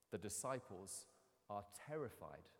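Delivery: ordinary delivery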